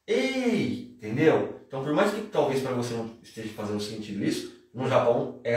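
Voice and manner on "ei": high, drawn-out challenge call; attacking call. Shown as a demonstration. Film fighter about to attack a man, high mock challenge. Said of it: "atacar o cara falando".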